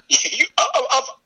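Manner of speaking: high, stammered mock attempts; can't get it out. Playing the son accusing his dad, stammering. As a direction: stutter on the word with a laugh creeping in. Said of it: "I don't even think he can get the words out".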